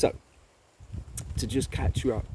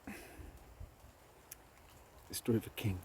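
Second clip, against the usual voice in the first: hushed story opening; 9 dB quieter, much slower than usual. A narrator's reading.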